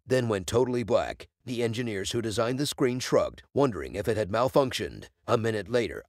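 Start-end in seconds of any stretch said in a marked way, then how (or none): none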